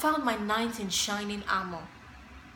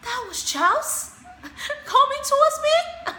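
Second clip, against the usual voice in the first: high, slow, incredulous laugh; laughing in disbelief; 14 semitones higher, 5 dB louder, slower than usual. Real, not acted. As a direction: laugh at the absurd reveal. Disbelief at her date.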